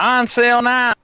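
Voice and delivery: high, loud pitchman call; mock ad announcer. Streamer parodying an ad pitch. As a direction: sell it like a TV ad.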